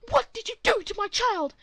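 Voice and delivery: high, loud furious shout; angry mother. Acted. Angry mother confronting the teen, shouting. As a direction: scream it at him like an outraged mom. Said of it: "E M comes running".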